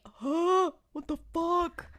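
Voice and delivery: high-pitched, aghast disbelief; mock shocked gasp. Acting out viewer's shocked reaction.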